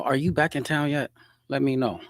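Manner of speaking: ordinary delivery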